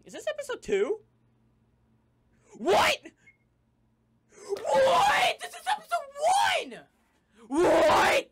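very high, loud panicked yells; shocked screaming. Acted. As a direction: shriek it in disbelief. Panicked yelling about the episode.

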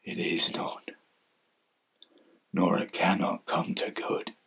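very low, soft, fading into whisper; hushed heartbreak. Acted. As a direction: sink into a broken-hearted whisper. Resigned close, must stay silent.

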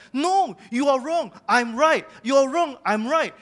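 loud, insistent, high-pitched quarrel; arguing angrily. Retold or imitated. Husband quarrelling with his wife, loud and insistent. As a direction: hammer home that she is wrong. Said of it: "I'll be like".

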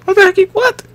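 high, loud yelp of surprise; startled outburst. Real, not acted. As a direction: caught off guard, shrieking up high. Sudden glitch in the game.

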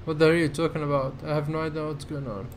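fast, tumbling confusion; rapid baffled rant. Real, not acted. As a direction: rattle it off in disbelief.

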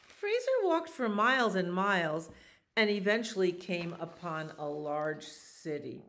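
animated picture-book narration; reading to children. A narrator's reading. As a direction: tell the story to little listeners. Reads picture book aloud.

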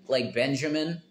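ordinary delivery

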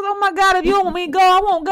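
the daughter rushing to take it all back; alarmed daughter. Retold or imitated. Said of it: "She goes".

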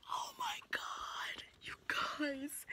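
breathless high whisper; excited whisper. Real, not acted. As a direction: whisper in giddy disbelief. Whispered excitement before premiere.

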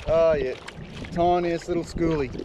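usual speaking voice

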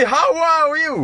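"how are you?" pitched high and drawn out slowly; mock English greeting. Streamer joking about village-style talk, teasing greeting. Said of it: "ibarat kata macam ke".

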